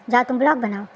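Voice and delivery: normal talking voice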